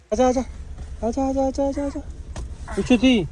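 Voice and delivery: high, sing-song beckoning. Real, not acted. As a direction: coax a little one over in a high voice. Calling the small child over.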